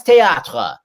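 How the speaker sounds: "théâtre" in French, tay-AH-truh; pretentious French. Shown as a demonstration. Host showing a guest the pretentious style, "théâtre" in French. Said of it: "normal style or pretentious style".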